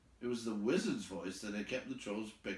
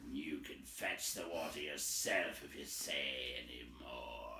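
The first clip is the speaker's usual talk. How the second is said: lower, softer troll growl; low troll threat; 8 semitones lower, 7 dB quieter than usual. A narrator's reading. Trolls threatening Tom, low and soft. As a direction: drop it low and menacing. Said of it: "said they".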